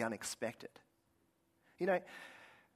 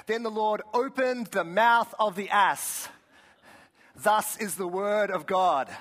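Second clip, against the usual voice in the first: loud, high, slow scripture declamation; King James recitation; 6 semitones higher, 7 dB louder, slower than usual. Acted. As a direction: proclaim it slowly with mock grandeur. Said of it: "I love that phrase".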